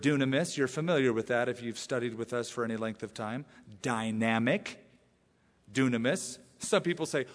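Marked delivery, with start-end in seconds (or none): none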